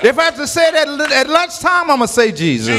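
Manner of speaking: high, fast rhythmic preacher's build; chanted sermon climax on the name; preaching at full force. Acted. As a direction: drive each line up, land on Jesus. Preaching crescendo on Jesus.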